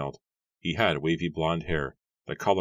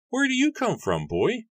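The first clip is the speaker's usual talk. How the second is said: much higher, put-on voice for the farmer; gruff farmer Matthew; 4 dB louder than usual. A narrator's reading. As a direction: play the grumpy farmer, pitched way up. Matthew questions the young man.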